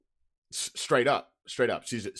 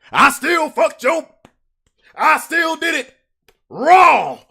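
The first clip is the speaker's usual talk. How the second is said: much louder, higher yelled boast; defiant shout; 9 semitones higher, 8 dB louder, slower than usual. Real, not acted. Boasting to viewers he'd still win, shouting. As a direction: shout it out raw.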